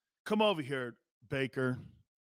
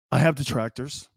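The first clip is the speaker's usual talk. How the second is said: mock dramatic; 6 semitones lower than usual. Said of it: "It's like a sonnet".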